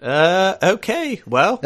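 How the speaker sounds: put-on posh-phrased British accent. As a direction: play the very British guest, drawing out the formal phrasing. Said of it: "as enjoyable as this accent is".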